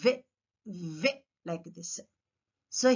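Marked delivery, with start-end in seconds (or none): 0.0-1.1 "వే" with top teeth just inside bottom lip; V sound. Shown as a demonstration. Teacher showing where teeth go for V, buzzed "వే". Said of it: "just inside the bottom lip".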